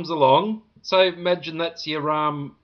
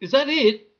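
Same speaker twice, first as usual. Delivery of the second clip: high, incredulous question at the tiny burger; dismayed disbelief; 9 semitones higher than usual. Retold or imitated. Diner staring at his plate, high dismayed disbelief. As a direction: pitch jumps up in stunned disbelief. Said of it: "I felt embarrassed".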